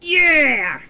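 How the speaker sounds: loud high whoop; triumphant cheer. Acted. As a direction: cheer in victory.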